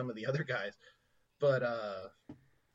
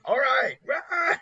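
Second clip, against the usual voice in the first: very high, loud, squeaky agreement; eager kids; 11 semitones higher, 8 dB louder, slower than usual. Retold or imitated. Imitating the Boy Scouts answering their leader, squeaky high. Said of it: "And they're like".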